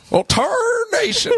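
very high, slow twangy exclamation; old prospector. Pretend. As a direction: squeal it like an old-timer. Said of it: "like the old-timey prospector".

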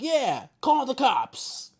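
high, loud, drawn-out mock holler; slow, high-pitched imitation of a shout; imitated shout. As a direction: stretch it out like a man hollering. Man echoes the yelled request.

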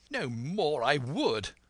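high, slow indignant retort; crying out. A narrator's reading. Retort to the captain on hearing him out, crying out. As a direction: exclaim it with stretched emphasis.

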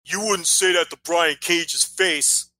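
slow, lightly voiced sneer of a Twitter commenter; a hint of a smug online troll; mocking Twitter guy. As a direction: parody the smug reply guy, slowly. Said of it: "who always responds to tweets like that".

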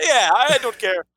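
mock Alan in a clumsy Irish lilt; bad Irish accent. Host imitating absent friend Alan's reply, bad Irish accent. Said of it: "what kind of Alan accent that was".

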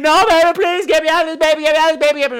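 mock a victim begging to be freed; mock screaming victim.

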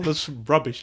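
ordinary talk

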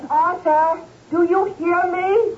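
high, worried call with a catch; pleading high cry to husband; anxious call. Acted. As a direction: call through the door, scared. Wife calls anxiously through door.

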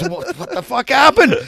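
high, loud imitated outburst; retold shock. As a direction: act out a friend's stunned demand. Acts friend's shock at accident.

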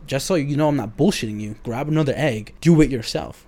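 mock insistent healer; forceful challenge. Retold healer challenging him.

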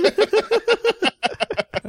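big, high cackle; high burst of laughter. Real, not acted. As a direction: crack up at the joke.

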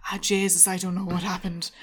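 a failed, lightly put-on Irish accent; mock Irish exasperation. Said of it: "both try and do Irish accents there and both fail".

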